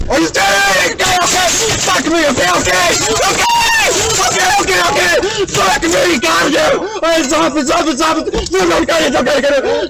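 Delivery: high-pitched, frantic pleading and cursing; real panicked yelling. Real, not acted. Driver being forced out by police, panicked shouting.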